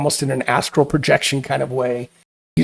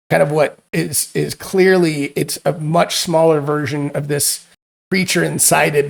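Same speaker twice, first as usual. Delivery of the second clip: ordinary delivery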